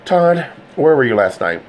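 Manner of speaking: straight-out, no softening; blunt question. A narrator's reading. Detective asking Todd about last night, blunt. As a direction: ask it flat-out, no niceties. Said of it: "asked bluntly".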